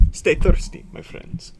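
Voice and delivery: loud high start, then a whisper; ad narrator voice. Retold or imitated. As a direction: sell the slogan like a commercial. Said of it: "like, advertising".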